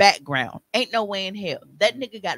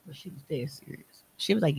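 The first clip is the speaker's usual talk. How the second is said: normal talking voice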